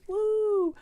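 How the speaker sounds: high, loud celebratory whoop; gleeful cheer; excited woo. Real, not acted. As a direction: let out a drawn-out high woo. Cheering about the weekly readings.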